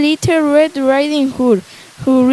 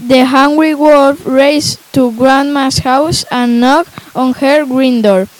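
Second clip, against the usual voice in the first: ordinary delivery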